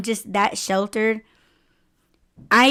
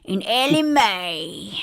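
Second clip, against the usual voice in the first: slow drawled put-on accent; 4 semitones lower, slower than usual. Shown as a demonstration. Mocking the accent for a laughing friend, slow drawl. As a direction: drawl "diabetes in Ellie Mae" slowly in a country voice. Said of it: "I'll just say it like this".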